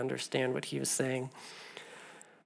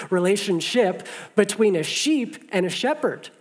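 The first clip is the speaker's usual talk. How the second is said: passionate emphasis; 5 semitones higher, 7 dB louder than usual. Real, not acted.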